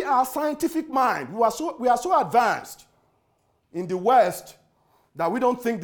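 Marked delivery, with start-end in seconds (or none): none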